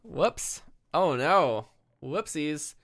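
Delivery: high, loud playful oopsie; mock alarm. Co-host pretends to regret letting a plot detail slip, mock alarm.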